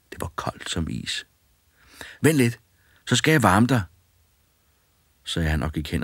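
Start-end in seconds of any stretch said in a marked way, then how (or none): 2.2-3.8 put-on boy's voice, higher and louder; cheeky boy. A narrator's reading. Fearless boy bossing the ghosts, cheeky and loud. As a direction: bold lad ordering the ghosts about.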